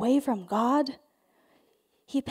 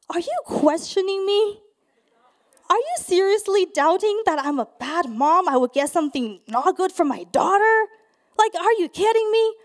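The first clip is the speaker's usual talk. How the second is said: higher, loud indignant retort; offended; 5 semitones higher, 5 dB louder than usual. Retold or imitated. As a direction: play up the wounded outrage. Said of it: "I got super offended".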